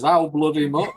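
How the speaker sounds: loud, high, laughing Yorkshire grumble; a tight-fisted Yorkshire chairman balking at the price. Retold or imitated. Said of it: "the chairman goes".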